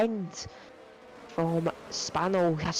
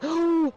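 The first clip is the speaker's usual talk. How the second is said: sharp gasp; 11 semitones higher, 5 dB louder than usual. Real, not acted. Crash seen during race commentary.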